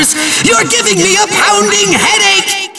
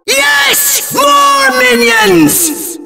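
high, slow, exultant summons; gleeful villain cry; 7 semitones higher, much slower than usual. Acted. Villain calling up more minions, high gleeful cry. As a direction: crow it in evil delight.

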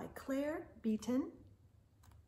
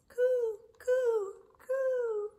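dove cooing; 14 semitones higher, 7 dB louder, much slower than usual. Acted. Reader voicing the dove for children, high coo.

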